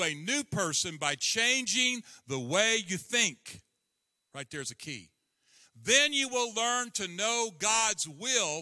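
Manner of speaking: earnest, measured Bible reading, slowed on key words; scripture reading. Acted. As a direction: read the verses as a plea to the congregation. Reads scripture aloud.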